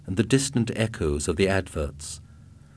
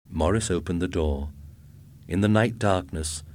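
ordinary delivery

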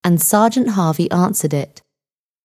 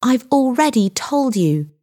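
higher-pitched, mildly exasperated reply; impatient; 6 semitones higher than usual. A narrator's reading. Girl questioned over and over by sergeant, impatient. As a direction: answer with a touch of impatience. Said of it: "said Linda impatiently".

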